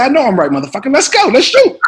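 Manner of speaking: high, loud brash tough-guy voice; Sam Jackson voice. Retold or imitated. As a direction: bark it like a swaggering movie star. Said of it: "He said".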